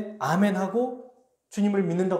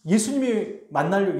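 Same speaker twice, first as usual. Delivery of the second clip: ordinary delivery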